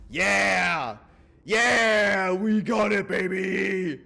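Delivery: high, loud victory yell; triumphant shout. Real, not acted. Streamer cheering that the scheme worked, triumphant shout. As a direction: cheer like you just won. Celebrates success.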